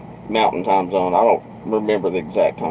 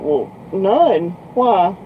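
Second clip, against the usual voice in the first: much higher-pitched mimicry; imitated defensive girl; 11 semitones higher, much slower than usual. Girl answering trucker about other guys, imitated defensive reply. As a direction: play the girl answering back, high.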